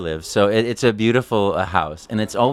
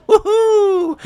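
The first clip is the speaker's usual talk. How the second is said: very high, louder Mario woohoo; 6 dB louder than usual. Shown as a demonstration. Mario's cheer as it sounds now. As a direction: whoop it like today's Mario. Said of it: "sound a little bit more like this".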